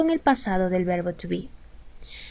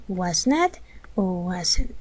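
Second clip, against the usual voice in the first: was not o wasn't, slowed and softened, each word given room; slow repeat; 4 semitones lower, 5 dB quieter, much slower than usual. Shown as a demonstration. Teacher repeating both forms slowly for the class. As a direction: spell it out slowly a second time. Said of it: "Es lo mismo".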